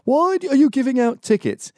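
high, loud mocking imitation; mimicked Indian man. Indian man demanding tickets, high mock-indignant voice. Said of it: "Like that Indian dude".

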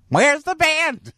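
put-on very high, slow old-lady squawk; Clara Peller flub. Old lady botches her line on live TV, high slow squawk. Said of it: "She goes".

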